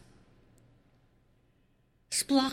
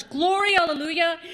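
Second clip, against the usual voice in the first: mock the crowd's cry of glory; joyful shout; 6 semitones higher, 5 dB louder than usual. Preacher voicing the funeral crowd, joyful cry. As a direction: call it out high and glad.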